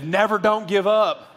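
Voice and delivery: slow put-on Southern accent. Preacher reading a double-negative tattoo, slow Southern accent. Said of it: "I don't know why I went Southern".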